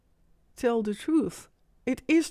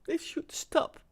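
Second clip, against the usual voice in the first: overcome with emotion; 5 semitones higher, 8 dB quieter than usual. Real, not acted. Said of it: "I'm just too emotional".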